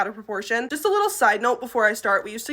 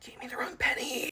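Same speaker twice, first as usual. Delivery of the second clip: hissed accusatory whisper; mock-sinister voiceless whisper; menacing whisper; 9 dB quieter than usual. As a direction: whisper it like a glare from across the room. Mimics customer glaring across room.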